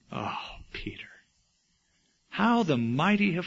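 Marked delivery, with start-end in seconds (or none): 0.1-1.1 a broken, tearful whisper; sorrowful whisper. Real, not acted. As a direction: grieve for him under your breath. Grieving over Peter's fall.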